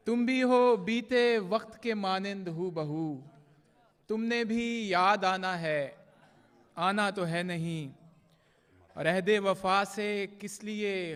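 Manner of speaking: measured, projected mushaira verse with drawn-out line endings; poetry recitation. Acted. Poet reciting love couplets to a mushaira audience, declaimed Urdu verse. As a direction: recite the ghazal line by line, pausing between phrases.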